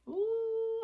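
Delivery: high, sudden ooh; a little squeak of realization; high surprised ooh. Real, not acted. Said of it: "have to stretch that out".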